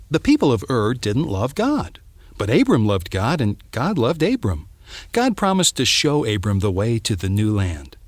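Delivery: ordinary delivery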